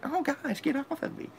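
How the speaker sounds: hammed-up prim protest; mock prissy. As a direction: play the delicate one, mocking. Mocking prissy reaction.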